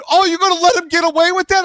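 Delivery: high, quick incredulous goading; retold egging-on. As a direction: imitate onlookers urging him to react. Voicing passengers in another car.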